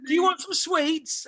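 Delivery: high, drawn-out singsong offer; imitating flight attendant. Flight attendant offering a passenger sweets, imitating her high and slow. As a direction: put on a high, slow cabin-crew voice.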